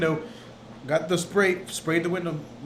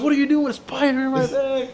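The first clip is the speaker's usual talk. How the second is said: high-pitched imitation of mom; mom's outcry; 8 semitones higher than usual. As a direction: exclaim it as a startled mom. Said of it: "she was like".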